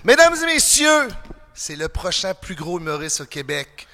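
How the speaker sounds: shouted opener, then low drawn-out buildup to a roared name; ring announcer introduction. Acted.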